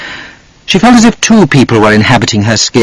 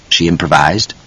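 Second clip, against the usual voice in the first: usual speaking voice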